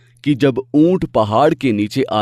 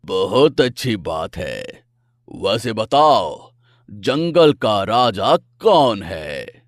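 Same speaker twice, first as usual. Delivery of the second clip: slow lordly lion; 3 semitones lower, slower than usual. Acted. Lion questions deer.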